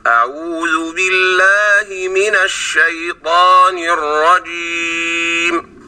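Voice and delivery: slow, measured chanted recitation; Quranic recitation. Shown as a demonstration. Teacher reciting the istiadha as a model in slow recitation. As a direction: intone the istiadha slowly and evenly. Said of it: "نقرأ لفظ الاستعاذة فنقول".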